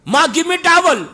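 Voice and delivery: high, loud demand for a towel; bossy order. Retold or imitated. Son bossing his mother for a towel, high demand. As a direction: order it, no please.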